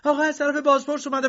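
very high, loud and fast, stress on آقا; frantic shout. A narrator's reading. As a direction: cry it out urgently. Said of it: "زن داد کشید". («آقا از طرف بازپرس اومده» با فریاد تند و زیر)

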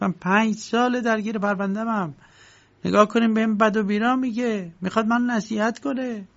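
climbing high into wounded outrage; aggrieved complaint. Acted. Block tells the lawyer about K.'s insults, aggrieved complaint. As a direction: plead your grievance, pitch rising sharply.

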